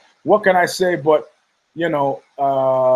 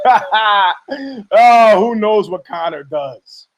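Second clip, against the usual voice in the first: a laughing, slow brush-off, a bit louder; mock dismissive laugh; 4 semitones higher, 5 dB louder, slower than usual. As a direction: shrug it off with a fake chuckle. Imitating Dana laughing dismissively.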